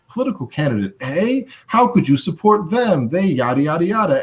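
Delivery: incredulous, high-pitched challenge; acted-out indignant comeback; jumping down their throat. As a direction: pounce on it with disbelieving questions. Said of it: "jump down their throat and be like".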